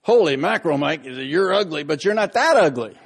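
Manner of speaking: loud booming shout across the room; big teasing roar; booming announcement. Retold or imitated. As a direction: bellow it so the whole room hears. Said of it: "in a voice that could have been heard in Baltimore".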